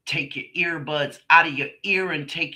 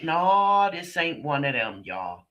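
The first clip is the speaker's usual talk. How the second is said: ordinary talk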